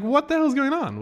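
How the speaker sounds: high, exasperated disbelief; baffled exclamation. Retold or imitated. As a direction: blurt it out, totally baffled. Retells his baffled reaction.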